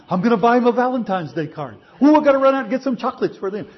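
preacher mocking a lovesick churchgoer, giddy squeal; hammed-up lovesick gush. Mock infatuated quote, valentine.

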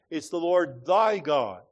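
"thy" pressed hard against "Lord God", slowed; slow phrase with the weight on "thy"; stress on thy. Real, not acted. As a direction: hit the word "thy" to set it against plain "Lord God". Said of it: "It's not simply the Lord God".

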